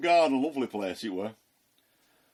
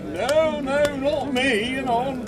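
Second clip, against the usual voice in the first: much higher, louder, slow pleading no; desperate denial; 13 semitones higher, 6 dB louder, much slower than usual. Retold or imitated. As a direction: drag out the denial in panic. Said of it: "And I'm like".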